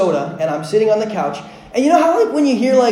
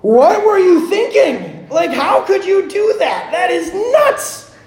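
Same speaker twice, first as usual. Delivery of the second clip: incredulous parental outburst, pitched way up; exasperated father; 11 semitones higher, 3 dB louder than usual. Retold or imitated. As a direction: scold your kid out of sheer fright. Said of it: "this trepidation, was like".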